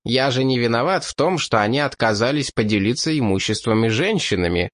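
usual speaking voice

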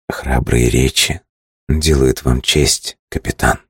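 low, slow, husky murmur; low voice from the chest; low chesty voice. Acted. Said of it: "низким грудным голосом". (низкий грудной голос)

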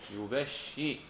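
يبشي as yu-bash-shi, with held sh; doubled-sheen reading. Shown as a demonstration. Teacher illustrating the doubling, يبشي as yu-bash-shi. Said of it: "مع التشديد".

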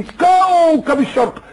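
high, drawn-out grand title; mock grandeur. Mocking the title given to a singer, grandly stretched. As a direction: proclaim it slowly in mock awe.

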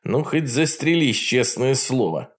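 plain reading voice

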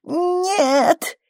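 high-pitched drawn-out wail of no; anguished cry. A narrator's reading. Labouring Bella to the women around her, anguished cry. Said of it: "издала низкий крик".

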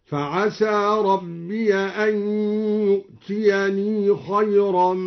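slow chant, ربي said rabbiya and يؤتيني with long final i; open ya, sustained ya. Shown as a demonstration. Teacher demonstrating al-Duri's reading, slow tartil with fatha on ربي. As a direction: chant slowly, opening ربي to -ya. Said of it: "مع فتح ياء فعسى ربي هكذا".

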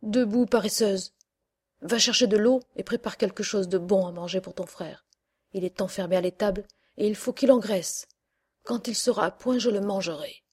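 loud harsh wake-up bark; witch shouting. Acted. Witch waking Gretel to fetch water, shouting. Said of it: "s'écria".

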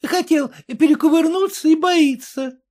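высокий насмешливый бабий говор; поддразни, как рыночная торговка; баба-торговка. A narrator's reading. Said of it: "баба-торговка произнесла". (торговка у калитки насмешливо)

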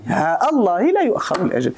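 short "ها", then "الله" restarted on a glottal stop; no lengthening of ha, clear break before "الله"; short vowel, cut hamza. Shown as a demonstration. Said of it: "بالقطع دون مد". («ها» قصيرة مع قطع همزة «الله»)

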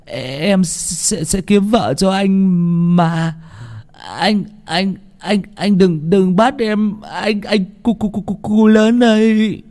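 high, stammering frightened pleading; delirious mumbling. A narrator's reading. Boy coming round begs spirits to spare him, delirious mumbling. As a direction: stutter the words, rising into a drawn-out, terrified plea. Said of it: "thằng Tèo cũng dần tỉnh lại lẩm bẩm".

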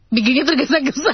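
laughing hard through the words. Real, not acted. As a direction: bursts into helpless laughter. Tertawa soal tergesa-gesa. (tertawa terbahak-bahak)

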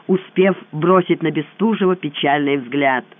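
usual speaking voice

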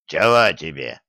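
lightly voiced cross werewolf. A narrator's reading. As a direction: grumble it at him, annoyed. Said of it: "сердито спросил жучок".

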